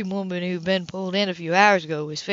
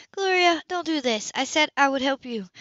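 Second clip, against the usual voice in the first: higher, lightly voiced pleading sister; Sybil, pleading; 7 semitones higher than usual. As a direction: gently urge her sister to stop.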